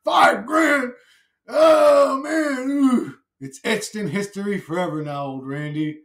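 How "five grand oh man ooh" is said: high, loud shocked wail; shocked outcry. Retold or imitated. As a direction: cry out in disbelief at the price. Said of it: "I'm gonna be like".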